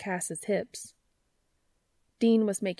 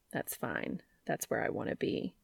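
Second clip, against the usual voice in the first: much lower and softer, half whispered; hushed low murmur; soft intimate whisper; 7 semitones lower, 7 dB quieter than usual. Acted. Cass's intimate reply.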